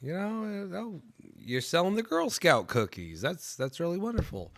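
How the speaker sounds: put-on high-pitched gushing at a little kid; talking to a small child, sing-song and high; sweet talk to a child. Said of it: "I'm just like".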